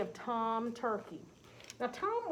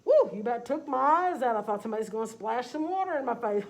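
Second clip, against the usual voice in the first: loud hooting dog with a laugh; 8 dB louder than usual. Acted. Farm dog teasing Tom about being blue, loud laughing voice. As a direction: hoot it out, half laughing.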